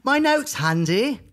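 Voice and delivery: high, put-on smug tone; mock smug voice. Podcast host mocking his co-host's jab in a high, smug voice. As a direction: echo the jab back in a silly high voice.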